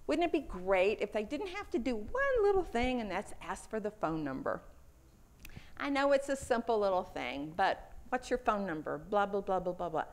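ordinary delivery

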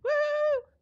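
high loud whoop; loud high woo; excited cheer. Real, not acted. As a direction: whoop for joy.

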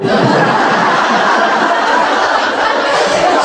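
long airy laugh at the punchline; breathy laugh. Real, not acted. Doctor laughing at the patient's joke, airy and long. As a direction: let out a silent wheezing laugh.

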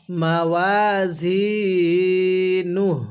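very slow, drawn-out tilawat; slow recitation. Shown as a demonstration. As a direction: chant it slowly for students to repeat. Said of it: "কিভাবে পড়ব?". (ধীর তিলাওয়াত)

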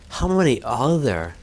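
alarmed shout. Real, not acted. Monsters appear, alarmed.

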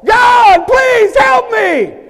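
hammed-up high, drawn-out shout to God; mock desperate prayer. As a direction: wail a plea to heaven at full volume. Imitates desperate prayer before the promotion.

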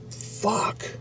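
whispered under the breath; hushed disbelief; stunned whisper. Real, not acted. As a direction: drop to a whisper in shock. Stunned reaction to train story.

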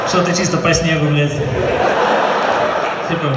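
ordinary talk, nothing marked

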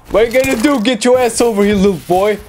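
high, mocking taunt. Real, not acted. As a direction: goad the enemy to come closer. Taunting an enemy.

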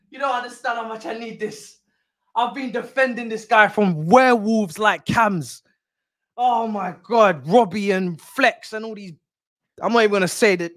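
usual speaking voice

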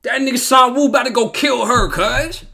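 high, loud alarmed shouting; agitated yelling. Real, not acted. Viewer yelling a warning at the screen, agitated. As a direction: warn the character at the top of your voice.

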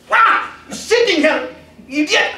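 sudden high furious yell at the beast; angry shout. Retold or imitated. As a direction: bellow it out as you strike with the stick. Washerman beats the sleeping "donkey.

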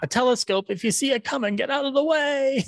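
high, squeaky timid voice rising to the top; a little nebbish blurting out a warning; Peabody voice. Retold or imitated. Fan imitating the salesman's punchline, very high and squeaky. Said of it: "And he goes".

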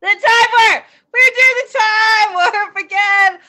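loud, slow, excited shout. Real, not acted. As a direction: belt out the song title. Host calls out song line.

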